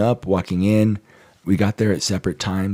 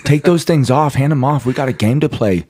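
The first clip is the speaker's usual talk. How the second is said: higher, louder playful shout; hammed-up coach hollering at the team; mock coach yell; 6 semitones higher, 6 dB louder than usual. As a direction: bark it like a coach telling players to put the rings down. Mock yelling at players with rings.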